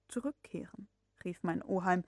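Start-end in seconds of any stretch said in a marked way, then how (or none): 0.1-0.9 soft, inward musing; to himself. Acted. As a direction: say it to yourself, not to him. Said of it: "als antworte er sich selbst".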